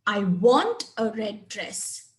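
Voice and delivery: "want" stressed with a falling tune, slow. Shown as a demonstration. Teacher showing stress on "want" to the class. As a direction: press on "want" as if you crave it. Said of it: "I really, really want it".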